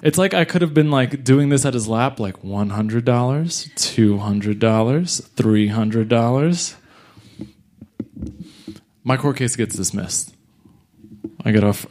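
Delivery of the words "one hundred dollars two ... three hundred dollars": slow count, "dollars" lifting each time; stretched, questioning lift on every "dollars"; rising count. Retold or imitated. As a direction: draw out each amount, ending "dollars" on a rise. Mimes a bit at sleeper's lap.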